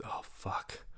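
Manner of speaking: breathy whisper of embarrassment; whispered oh fuck. Retold or imitated. Friend realizing her gaffe, whispered. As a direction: hush it, caught out.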